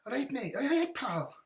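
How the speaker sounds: high, loud Scottish accent, hammed up. Greeting a Scottish viewer, playful Scottish accent. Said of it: "me doing a Scottish accent".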